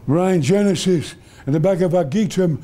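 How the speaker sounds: lightly put-on voice of a rocket scientist; a hint of an eccentric scientist's voice; Zubrin. Scientist quoting scripture to Brian, lightly imitated voice. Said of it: "He talked like that".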